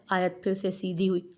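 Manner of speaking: ordinary delivery, nothing marked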